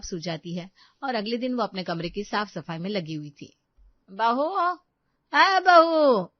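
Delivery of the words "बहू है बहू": loud, insistent summons; calling out. Acted. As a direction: holler for your daughter-in-law, louder the second time. Mother-in-law calls from outside.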